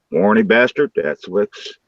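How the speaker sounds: ordinary delivery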